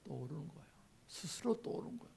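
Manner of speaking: hushed whisper. Real, not acted. Preacher whispering to the congregation in awe. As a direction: drop to an awed whisper.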